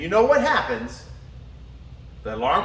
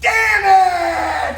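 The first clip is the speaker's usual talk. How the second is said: loud, high angry curse; angry shout; 9 semitones higher, 7 dB louder than usual. Retold or imitated. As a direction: yell it out furious. Girlfriend raging on waking.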